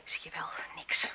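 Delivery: soft, breathless whisper to herself; hushed and uneasy; nervous whisper. Acted. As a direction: whisper it, calming herself in the dark. Alone at night, scared.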